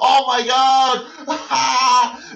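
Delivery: slow, loud, laughing oh my God; laughing exclamation. Shown as a demonstration. Teacher acting out laughing at funny videos. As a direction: say it through laughter. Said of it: "you laugh out loud".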